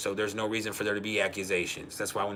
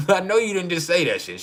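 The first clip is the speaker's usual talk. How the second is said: high, louder mock outrage; retold indignant challenge; 8 semitones higher, 6 dB louder than usual. Husband challenging wife in a morning spat, mock indignant. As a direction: challenge her, half playing.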